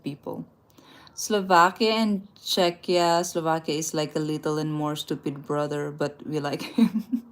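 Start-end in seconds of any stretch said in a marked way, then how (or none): none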